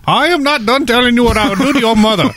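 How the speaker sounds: a creepy caller taunting; menacing telemarketer. Retold or imitated. Telemarketer calls back.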